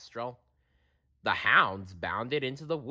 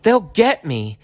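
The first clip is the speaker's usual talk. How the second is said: desperate; 10 semitones higher, 5 dB louder than usual. A narrator's reading. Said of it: "she thought desperately".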